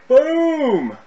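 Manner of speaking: loud high burst of hype; excited shout. Real, not acted. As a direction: shout it out, pumped. Streamer hypes new DIY video.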